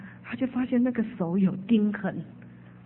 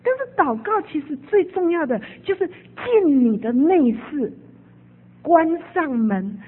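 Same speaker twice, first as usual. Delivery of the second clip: fervent preaching; 5 semitones higher than usual. Acted. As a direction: press the point home to the brothers and sisters. Preacher exhorts congregation.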